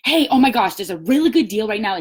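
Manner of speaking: bursting in with a loud, high opener; excited pitch. Shown as a demonstration. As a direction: demonstrate an excited sales pitch, gushing about a bargain. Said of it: "You need to be excited".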